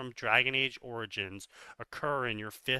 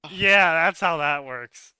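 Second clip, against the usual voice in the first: loud sarcasm; 3 semitones higher, 10 dB louder than usual. Real, not acted. Sarcastic jab at the writer's claim.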